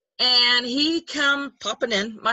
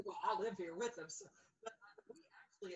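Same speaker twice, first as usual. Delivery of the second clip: speaking from across the room, much softer and distant; far from the mic; 20 dB quieter, faster than usual. Real, not acted. Telling her friend about the cats from too far off the mic. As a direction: step away from the phone and keep talking. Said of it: "I can't hear. Holly, come back".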